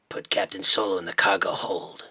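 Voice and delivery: low, menacing film-villain line; original Boba Fett. Acted. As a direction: growl it like the original bounty hunter. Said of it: "I prefer the original".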